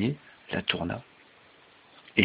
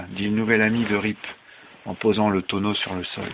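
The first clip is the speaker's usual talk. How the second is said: ordinary delivery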